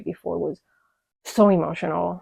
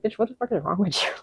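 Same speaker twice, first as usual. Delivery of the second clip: imagined bewildered stare; 2 semitones higher, 4 dB quieter, faster than usual. Retold or imitated. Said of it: "she's looking at me like".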